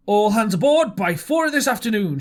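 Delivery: loud, high call after him; shouted. A narrator's reading. Squire calling after departing Silver, loud shout. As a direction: call it across the room. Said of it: "shouted the squire after him".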